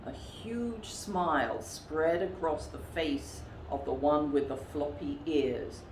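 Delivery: plain reading voice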